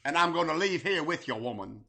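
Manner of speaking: lightly voiced leering wrestler; Honky Dusty Rhodes voice. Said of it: "That was like honky Dusty Rhodes".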